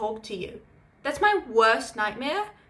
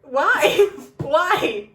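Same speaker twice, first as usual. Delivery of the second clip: higher, louder laughing "why"; laughing disbelief; 5 semitones higher, 7 dB louder than usual. Real, not acted. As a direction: laugh through the question. Friend echoes disbelief, laughing.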